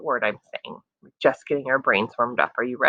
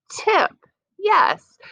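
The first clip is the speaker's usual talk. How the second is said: excited answer. Real, not acted. Teacher cheers right answer.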